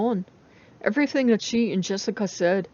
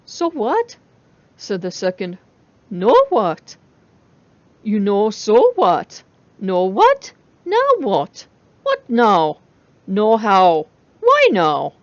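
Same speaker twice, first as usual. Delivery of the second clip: robotic babble; 7 dB louder, slower than usual. A narrator's reading. Machine faces babbling.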